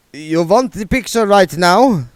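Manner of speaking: put-on eager, accented reassurance; Otto vampire accent. A narrator's reading. Otto promising William in an Eastern European accent. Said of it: "said Otto".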